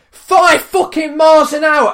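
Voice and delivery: high, loud, slow outraged yell; outraged bellow, re-enacted; retold shout back. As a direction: shout it back, hammering each word. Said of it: "so I shouts back".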